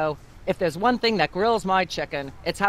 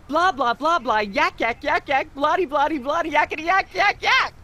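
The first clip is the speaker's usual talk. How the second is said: rising blah blah tirade; 6 semitones higher than usual. Acted. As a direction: babble nonsense, pitch climbing to a squeak. Host's rising mocking tirade.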